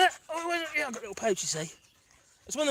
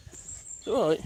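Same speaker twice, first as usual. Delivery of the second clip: usual speaking voice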